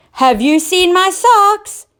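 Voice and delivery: high, loud, slow call up the stairs; king shouting up to his wife about socks; shouting upstairs. Acted. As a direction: call up to the Queen, worried. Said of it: "he shouted up to the Queen".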